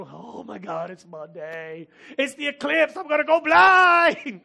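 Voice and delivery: imitating a panicked crowd, rising to a shout; mock-panicked yell about going blind. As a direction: ham up the eclipse panic. Mocking panicked eclipse crowd.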